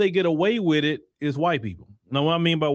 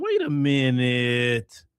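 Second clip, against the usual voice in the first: drawn-out, suspicious realization; slow, skeptical double take; dawning suspicion; 5 semitones lower, much slower than usual. Retold or imitated. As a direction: stretch it out slowly as it dawns on you. Acting out the group catching on.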